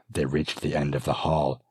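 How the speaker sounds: ordinary delivery, nothing marked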